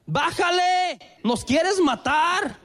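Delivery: high, alarmed shout, slow on the second line; overreacting shout. Wife Alba shouts at speeding husband, alarmed yell. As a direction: yell it like a panicked passenger. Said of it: "Alba se da cuenta y sobrereacciona".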